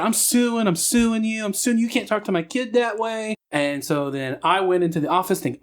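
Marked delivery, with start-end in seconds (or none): 0.0-3.4 retold indignant woman. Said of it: "This woman said".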